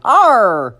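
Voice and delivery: swooping pirate growl and squawk; bellowing pirate. A narrator's reading. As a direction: toy pirate barking sea talk. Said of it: "egg-shaped pirate that bellowed".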